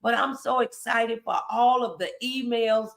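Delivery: ordinary delivery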